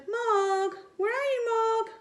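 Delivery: high calling across a dark garden. Acted. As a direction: call the cat in the night. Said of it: "They shouted".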